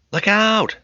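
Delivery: high, sharp shout of warning; girl warning her father of a skateboarder, high shout; cry of alarm. Acted. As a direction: yell it to stop him. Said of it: "Becky cried".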